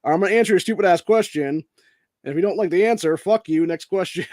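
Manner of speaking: hammed-up defiant yell; brash shout. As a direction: bark it at a reporter at a press stop. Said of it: "I'm gonna be like".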